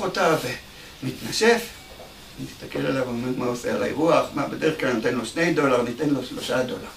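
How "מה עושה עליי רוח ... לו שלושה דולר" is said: soft, fast grumble under the breath; lightly voiced grumble. Bellhop grumbling to himself about the tip, soft and fast. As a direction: mutter it quickly to yourself.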